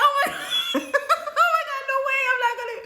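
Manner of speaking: high, loud squeal breaking into laughter; hammed-up flustered shriek, laughing; mock panic. Patient panics at exam offer.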